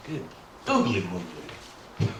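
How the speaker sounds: low, slow mock exclamation; a goofy low "good googly moogly"; comic exclamation. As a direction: drag it out in a silly put-on voice. Exclamation in odd voice.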